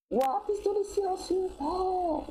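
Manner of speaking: high, drawn-out gasp of wonder; excited high-pitched wow; thrilled. Real, not acted. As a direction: marvel at the loot, voice way up. Thrilled at finding a silenced pistol.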